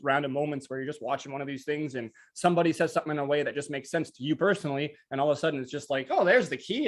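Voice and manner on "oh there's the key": excited realization. Retold or imitated. Sudden realization, retold.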